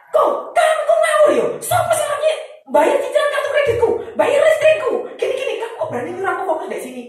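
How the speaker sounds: high, loud, laughing mock outrage; hammed-up indignant rant through laughter. Angry reply retold, laughing.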